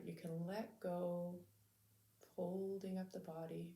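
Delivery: calm, measured meditation voice with long pauses; unhurried, soothing lead-in to stillness; guided meditation. Real, not acted. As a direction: gently guide listeners to settle into the body.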